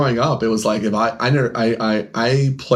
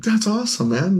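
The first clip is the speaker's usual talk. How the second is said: much higher, congratulating voice; put-on enthusiasm; 10 semitones higher than usual. Store owner congratulating a customer on his pull, put-on delight. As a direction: act delighted for him, pitched way up.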